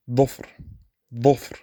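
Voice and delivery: dhufr with a heavy, dark emphatic dh. Shown as a demonstration. Teacher modelling emphatic dh in dhufr. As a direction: dhufr from the back of the mouth. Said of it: "the emphatic".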